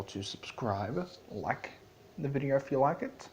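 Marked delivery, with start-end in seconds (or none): 2.2-3.3 higher, louder, fast showman outro; Discord sign-off. Acted. Discord signing off to his viewers, loud and gleeful. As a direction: rattle off the outro as a gleeful chaos lord. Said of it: "I've been Discord".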